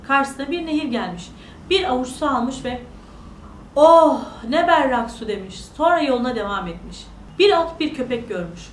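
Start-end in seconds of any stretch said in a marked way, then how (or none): 3.8-5.3 slow, louder exclamation of wonder; admiring. Acted. Boy tastes the river water, admiring. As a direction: marvel with a drawn-out "oh".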